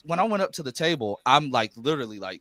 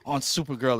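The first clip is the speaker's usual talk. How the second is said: stammering, starstruck re-enactment; imitated nervous fan stumbling over words. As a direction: act tongue-tied, restarting over and over. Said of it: "He's just nervous".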